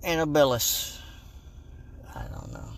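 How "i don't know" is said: hushed unvoiced whisper. Real, not acted. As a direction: whisper it to yourself. Whispered aside.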